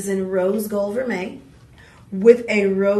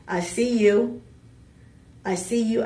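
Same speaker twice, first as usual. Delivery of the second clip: ordinary talk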